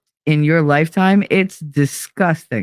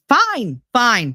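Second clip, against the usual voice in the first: higher, exasperated fine fine; exasperated giving in; 7 semitones higher than usual. Retold or imitated. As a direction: throw up your hands, fine fine. Giving in to son.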